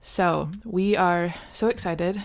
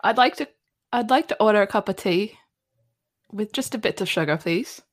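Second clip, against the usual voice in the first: put-on fast, loud English accent try with a Northern Irish lilt; attempted English accent, a bit Northern Irish; 3 semitones higher, 5 dB louder, faster than usual. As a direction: order tea in a rushed mock English accent. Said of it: "maybe a little bit Northern Irish".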